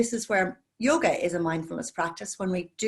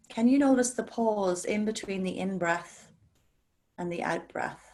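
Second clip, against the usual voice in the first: guided meditation. Acted. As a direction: ease the class into calm breathing, gently. Leads a guided breathing meditation.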